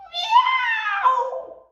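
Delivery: high, soft cat meow. A narrator's reading. Reading to a child, a soft high meow. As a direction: narrator mews softly as the worried cat. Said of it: "said Wilbur".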